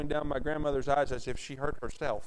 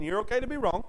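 usual speaking voice

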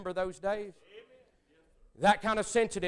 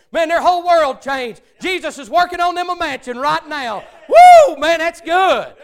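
loud, high joyful shouting; exultant shout; 10 semitones higher, 17 dB louder than usual. Real, not acted. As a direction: shout out the joy of salvation. Preacher rejoicing at full force.

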